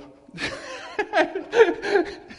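loud high burst of laughter; real hearty laugh; big laugh. Real, not acted. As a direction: laugh out hard with delight. Laughter after healing story.

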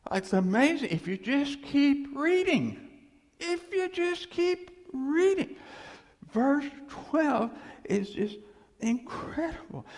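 high, loud rising wonder; preacher thrilled at the verse; excited. Real, not acted. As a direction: sell the amazement to the crowd.

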